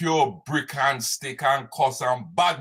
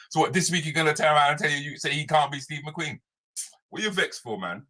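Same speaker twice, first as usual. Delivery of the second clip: ordinary talk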